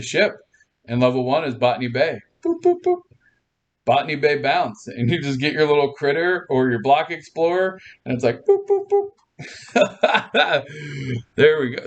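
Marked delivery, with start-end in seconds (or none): 2.4-3.0 high little game boops; cartoon bounce sound effect; bouncing boops. Shown as a demonstration. Imitates hopping game sound effect. 8.4-9.1 high little game boops; cartoon bounce sound effect; bouncing boops. Shown as a demonstration. Imitates bouncing critter sound. 9.7-11.3 real amused giggle; real laugh. Real, not acted. Laughs at own sound effects.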